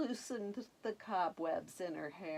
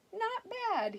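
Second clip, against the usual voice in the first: pleased prince; 13 semitones higher than usual. Acted.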